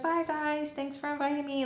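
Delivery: high, lightly voiced farewell; retold sweet goodbye. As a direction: imitate a friend's polite send-off. Friend's polite goodbye, acted out.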